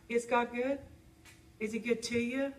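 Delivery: ordinary talk, nothing marked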